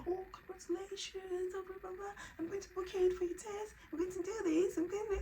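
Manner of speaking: high, gushing congratulations; excited friend. Retold or imitated. Midwife friend thrilled at the pregnancy news.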